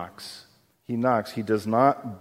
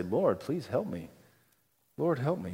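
hushed prayer; 9 dB quieter than usual. Pretend. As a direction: pray it quietly, almost under the breath. Voicing a humble prayer.

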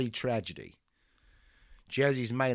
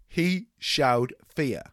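ordinary delivery

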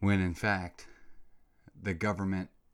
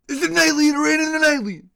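exasperated yell; 15 dB louder, faster than usual. Real, not acted. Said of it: "exasperated outburst at officials".

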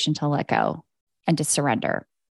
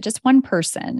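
ordinary talk

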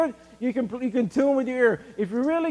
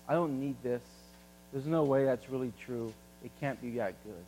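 quiet dismissive; 7 semitones lower, 9 dB quieter than usual. Retold or imitated. Voicing the unbeliever's dismissal.

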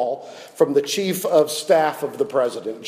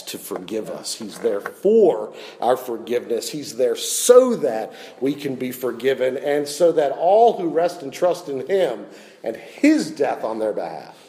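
usual speaking voice

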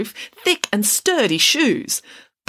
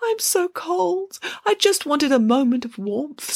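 put-on pitiful little girl; 9 semitones higher than usual. A narrator's reading. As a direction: plead like a freezing child. Freezing girl pleads.

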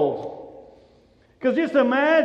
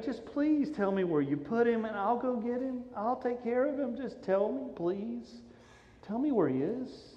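soft, imploring plea; pleading; 2 semitones higher, 9 dB quieter than usual. Acted. Mary begging the gardener for the body, pleading. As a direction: beg quietly, fading to a hush. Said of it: "And she goes".

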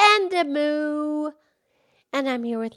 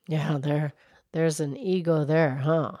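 much lower, gentle cow voice; Mildred the cow; 8 semitones lower, 6 dB quieter than usual. Acted. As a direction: drop the pitch low to play the old cow. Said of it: "Mildred the Moo Moo Cow".

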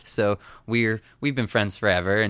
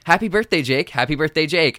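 bright, louder TV host greeting; TV host birthday greeting; 7 semitones higher, 4 dB louder than usual. Retold or imitated. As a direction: sing out the birthday wish. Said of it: "videos of Jen going".

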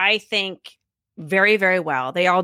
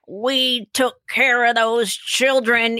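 put-on slow, higher-pitched old woman's voice; elderly woman; 4 semitones higher, much slower than usual. World War Two teacher looking back on her work, elderly and slow. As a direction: draw it out like a woman in her nineties. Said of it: "she's in her nineties".

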